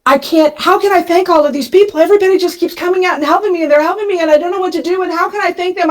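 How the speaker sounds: high, fast, panicked rush of worries; a breathless, high-pitched overwhelmed ramble; losing it. Retold or imitated. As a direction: spill it all out in a frantic flood. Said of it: "And I am losing it".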